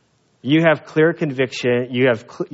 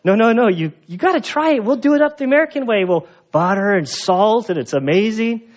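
higher, excited coaxing; eager insistence; 7 semitones higher than usual. Retold or imitated. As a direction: talk him into trying it. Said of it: "and they're like".

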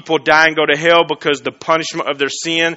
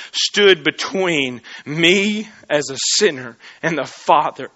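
ordinary talk

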